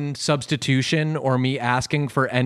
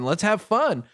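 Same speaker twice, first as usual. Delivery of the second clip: higher-pitched, casual buddy enthusiasm; playful egging on; 7 semitones higher than usual. Retold or imitated. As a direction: toss out ideas to a buddy in a higher voice. Acting out experimenting with sandwich-maker buddy.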